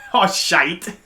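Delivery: broad mock British accent. Shown as a demonstration. Friend topping the joke, British accent. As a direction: lean into the British vowel in shite. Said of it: "say it in a British accent".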